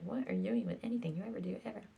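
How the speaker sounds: ordinary talk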